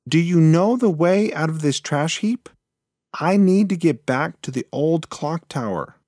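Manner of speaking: anxious to get home; pleading mouse. Acted.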